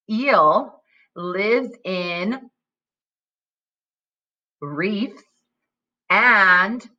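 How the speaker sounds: put-on slow, pausing read-aloud for young pupils; reading to children. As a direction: read it slowly, pausing for the kids to fill in. Reads decodable to children.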